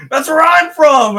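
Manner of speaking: high, loud, campy excited question; flamboyant voice. Retold or imitated. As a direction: go big and theatrical, a flamboyant diva.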